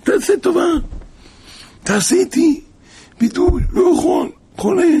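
high, loud, tearful plea of a dying man; sick man's cry. Retold or imitated. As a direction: wail like a man in pain begging. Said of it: "צועק, צועק". (זעקת חולה גבוהה ובוכייה)

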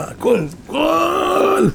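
high, long-drawn mock cry; retold desperate cry. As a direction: act out calling to every idol, stretching the last word high. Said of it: "צעק לכל השלוש מאות עבודה זרה". (זעקה מוגזמת, גבוהה וממושכת)

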